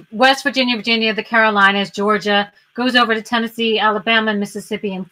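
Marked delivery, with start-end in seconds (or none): none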